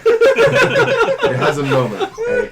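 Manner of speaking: talking through loud, high laughter; real laughter. Real, not acted. Game master gives in to the kick joke, laughing hard. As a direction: crack up while narrating.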